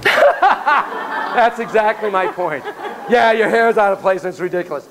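amused, speak through laughter; laughing. Real, not acted. Laughs at student's hair worry.

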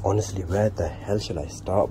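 ordinary talk, nothing marked

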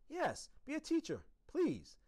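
high, loud, urgent squeak; insistent plea. A narrator's reading. Small pet urging the custodian to teach, insistent. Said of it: "I insisted".

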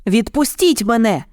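put-on high-pitched urgent child's call; boy's acted cry. A narrator's reading. Boy demands vultures let go, high cry. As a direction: cry out high, little extra volume. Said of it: "кричав Мауглі".

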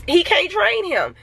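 high, worked-up imitation of outrage; overreacting. Owner recalling reaction to trainer's mistake, high-pitched overreaction. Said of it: "I was overreacting".

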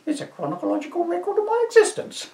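put-on high shrieking old woman; an indignant old dear shooing a Viking; old lady to constable, high and indignant; crone voice. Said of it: "my crone voice".

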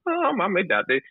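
high-pitched comic imitation of an actor; Mark Cox impression. Director mimicking an actor at a read-through, high put-on voice. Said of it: "the way Mark Cox speaks".

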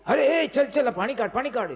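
high, loud urgent bustle; a wife rushing her husband while their child is sick, urgent and high; urgent hurrying. Retold or imitated. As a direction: hurry him along, pitched high and loud.

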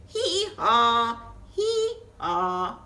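loud hee-haw braying; donkey bray. Pretend. Reading a farm book to kids, braying like a donkey. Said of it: "I bray".

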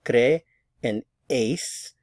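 "cre and eis" slowly, as separate beats; slow teacherly "cre" and "eis"; syllable by syllable. Shown as a demonstration. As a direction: split it for learners, "cre", pause, "eis". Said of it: "there are two syllables here".

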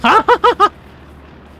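high burst of laughter; loud laugh. Real, not acted. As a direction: cackle at your own joke. Laughs after fake joke.

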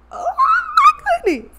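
high, slow, laughing oh my goodness; laughing amazement. Real, not acted. Tarot reader to the viewer, delighted, laughing high and slow. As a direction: squeal it out through a laugh, almost crying.

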